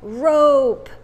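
rope called out loud and clear; emphatic model word. Shown as a demonstration. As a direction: rope hard en langgerekt. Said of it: "escucho el sonido".

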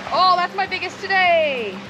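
high, drawn-out delighted cry over a catch; excited. Real, not acted. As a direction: stretch it out high, thrilled at your catch. Excited catch of a bigger fish.

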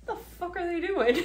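high, fast, laughing what-the-hell; incredulous laughing blurt; laughing disbelief. Pretend. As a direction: passenger gawking at the wreck, laughing in disbelief. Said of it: "looking down going".

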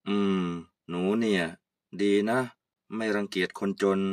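put-on a low, fond old man's approval; old uncle, kindly.

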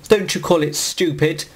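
normal talking voice

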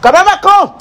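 high, urgent call to the cameraman. Real, not acted. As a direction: hurry the cameraman over. Urgently calling cameraman, high pitch.

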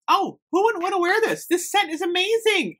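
high-pitched, real excitement; squealing with delight over a scent; thrilled raving. Real, not acted. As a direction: gush at the top of your range. Raving about surprise scent.

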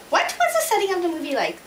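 ordinary talk, nothing marked

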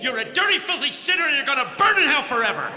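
hammed-up fire-and-brimstone shout; mock condemning preacher. Mock Jesus condemning Zacchaeus, hammed-up hellfire shout. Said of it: "That's not what he said".

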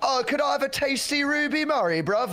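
high mock Cockney accent. Testing friends on slang in a Cockney accent. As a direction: put on a Cockney accent for a riddle. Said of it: "Cockney slang".

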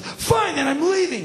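high-pitched huffy outburst; indignant. Retold or imitated. Burglar getting kicked out, loudly indignant. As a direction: storm off in acted indignation. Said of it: "He's totally indignant".

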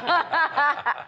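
laughs high at his own punchline; a pleased burst of laughter. Real, not acted. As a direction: chuckle at your own joke.

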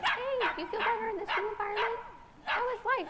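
high, sing-song dog-talk voice. Real, not acted. Trainer greeting the calmer dog, high coaxing pet voice. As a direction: coo at the dog like a pet owner. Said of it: "I'm talking to her here".

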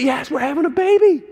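high, loud fake cheer; mock sarcastic excitement. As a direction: play Joseph's sarcastic yay. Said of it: "He was not excited".

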